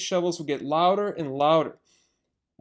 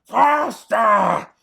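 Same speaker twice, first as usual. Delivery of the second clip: louder each time; shouting; 4 semitones higher, 8 dB louder, much slower than usual. Acted. Bogus urging the drivers, building shouts. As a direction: bark it at the drivers. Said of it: "hopping around ... and shouting".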